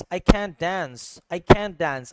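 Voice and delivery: American "can't dance", both with cat vowel; American accent. Shown as a demonstration. Teacher showing students how Americans talk, American accent. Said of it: "American यूं बात करेगा".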